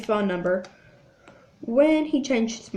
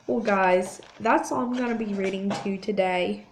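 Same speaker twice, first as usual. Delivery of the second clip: ordinary delivery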